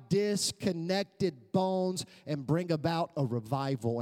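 high, slow, building proclamation; impassioned preaching. Acted. Preacher proclaiming God's miracles to church, impassioned preaching. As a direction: stretch each phrase toward a climax.

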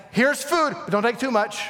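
high, bright call of offer; God calls out food, then warns. Retold or imitated. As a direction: announce the gift like a generous host. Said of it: "And so now God's saying".

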